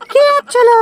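high put-on woman's voice; wife's voice. Young wife shooing her husband out, in a high put-on voice. Man voicing his wife.

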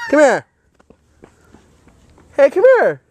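loud, high call to the dog. Real, not acted. Calling the dog over.

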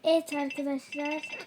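ordinary delivery

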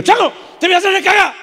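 a father yelling at his misbehaving son; scolding yell. Retold or imitated. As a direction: snap a threat at the boy.

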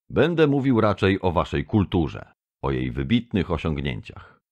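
ordinary delivery

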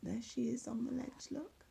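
soft whisper pointing at the picture; whispered aside. Real, not acted.

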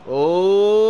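loud, long rising "ohhh"; excited "ooh". Real, not acted. Streamer reacts to the keeper's mistake, rising excited cry. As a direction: build the cry upward in anticipation.

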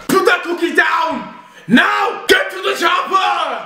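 high, shouted action-hero impression; Arnold Schwarzenegger. Son outdoing his mum's impression, shouted action hero. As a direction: yell it with a mock Austrian accent. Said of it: "Arnold Schwarzenegger".

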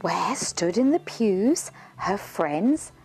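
ordinary delivery

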